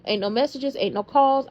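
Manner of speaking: ordinary delivery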